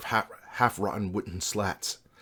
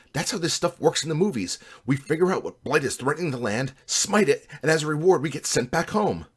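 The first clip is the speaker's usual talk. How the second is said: higher, lively hero pep; eager rallying; 6 semitones higher, 4 dB louder than usual. A narrator's reading. Buddy tells the party how movie quests work, eager. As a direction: pitch it up like an eager wingman.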